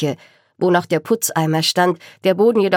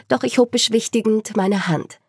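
ordinary delivery

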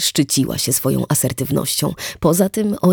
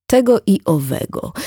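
trying out the phrase; 6 semitones higher, 3 dB louder than usual. Acted. Wit to Erika, testing "Tego i owego" as a new phrase. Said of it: "wypróbowując zwrot, jakby słyszał go po raz pierwszy".

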